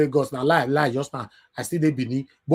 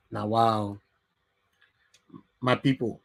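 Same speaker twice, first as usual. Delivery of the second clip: ordinary talk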